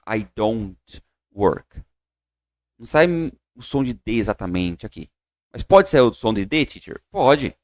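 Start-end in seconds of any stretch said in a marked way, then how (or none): 0.1-1.8 very slow, each word set apart; slow separated demo. Shown as a demonstration. Teacher shows the careful way with a clear d, slow. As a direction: stress the d of "don't" clearly. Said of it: "eu não digo".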